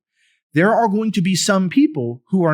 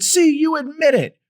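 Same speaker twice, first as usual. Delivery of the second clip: much higher, taunting gotcha voice; mocking the left; 13 semitones higher than usual. Host mocking critics who pounce on the commissioner, high taunt. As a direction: play a smug accuser crowing got you. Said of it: "They said".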